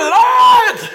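a high, loud cry of Lord; shouted call. Real, not acted. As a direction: call out to heaven. Calling out to God.

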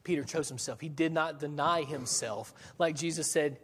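ordinary talk, nothing marked

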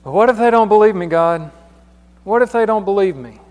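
loud, fast, high pleading Moses; anxious Moses. Retold or imitated. Moses pleading with God, loud and fast. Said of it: "verse one says".

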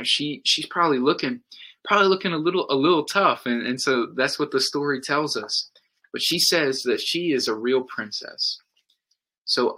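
ordinary talk

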